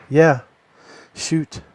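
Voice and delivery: ordinary talk, nothing marked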